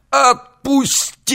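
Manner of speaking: high, slow pained shriek. Acted. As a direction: shriek it out in pain. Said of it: "визжал служитель поезда".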